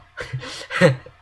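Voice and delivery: short loud unvoiced laugh; breathy laugh. Real, not acted. Builder laughing at his own muddle, breathy laugh. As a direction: burst out laughing at yourself.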